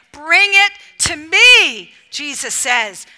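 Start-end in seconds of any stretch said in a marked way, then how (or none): 0.1-1.9 loud, slow, drawn-out call; emphatic proclamation. Acted. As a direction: proclaim it slowly, stretching each word. Emphatic take of Jesus's call.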